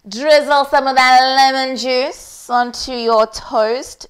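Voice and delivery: playful TV cook voice; cooking show host. Pretend. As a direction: present it like a celebrity chef on air. Hosting her own cooking show.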